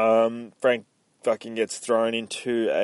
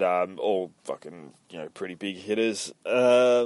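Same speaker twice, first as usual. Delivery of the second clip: ordinary talk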